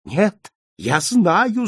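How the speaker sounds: slow, high, loud insistence; shouting. A narrator's reading. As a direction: cry out with frantic certainty. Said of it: "закричал швед". (отчаянный крик)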